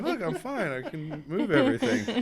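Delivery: high, laughing, I'm fine; laughing reassurance. Retold or imitated. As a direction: reassure him through laughter. Reassuring boss jokingly.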